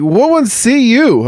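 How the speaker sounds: put-on very high, loud, slow kid question; imitated son. Son asking dad about the word, imitated kid voice. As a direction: squeal it like a curious kid, drawn out. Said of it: "And he's like".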